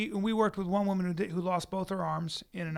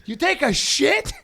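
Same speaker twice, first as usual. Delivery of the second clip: loud, high, slow mocking yell; hammed-up accusing shout as Dean; 11 semitones higher, 9 dB louder than usual. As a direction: Dean yelling at him in the bathroom, mock shout. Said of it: "he goes".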